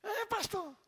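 put-on little boy agreeing nervously; child voice. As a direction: answer like a small boy. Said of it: "Ele fez assim".

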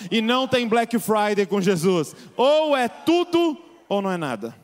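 loud fervent sermon climax; full-force preaching. Real, not acted. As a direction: preach it to the whole church. Pregação em força total. (pregação inflamada)

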